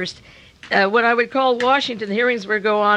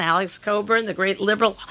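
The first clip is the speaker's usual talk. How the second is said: ordinary delivery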